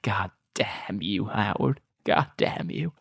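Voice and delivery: slow hoarse whisper; dramatic whisper. Retold or imitated. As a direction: hiss the curse under your breath. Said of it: "going like".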